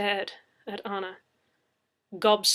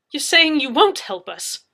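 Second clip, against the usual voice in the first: indignant cry; 6 semitones higher, 13 dB louder than usual. Acted. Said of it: "I cried".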